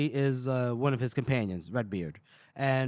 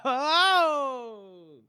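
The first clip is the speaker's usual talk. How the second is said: laughing oh; 9 semitones higher than usual. Real, not acted. Laughing at the boy's slip.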